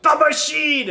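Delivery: high, loud celebratory shout; hammed-up mobster roar; mock cheer. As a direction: yell it like a rowdy drinking crowd.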